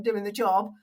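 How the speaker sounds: ordinary talk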